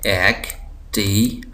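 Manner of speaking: Activity very slow, in four separate syllables. Shown as a demonstration. Teacher counting syllables for students, word split slowly. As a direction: break activity into एक, टि, वि, टी with pauses. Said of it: "चार syllable हैं".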